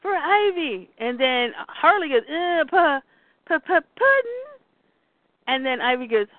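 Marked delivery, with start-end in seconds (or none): 0.0-0.9 acted urging, higher; rally someone who is out cold; raised-pitch retold appeal; urgent plea. Bruce urging Harley to wake. 2.3-4.4 acted stuttering, climbing high; halting, rising imitated stutter; dazed stammer. Harley stammering as she wakes.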